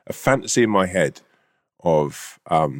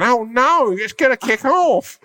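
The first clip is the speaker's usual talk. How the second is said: high, loud, slow mock-worried background character; panicky soap extra; 4 dB louder, slower than usual. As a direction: ham up a nervous pub extra. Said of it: "in the background going".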